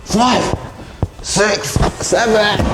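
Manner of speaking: high, strained counting under a heavy load; straining with effort. Real, not acted. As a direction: push each word out while lifting. Counting steps while carrying heavy mattress.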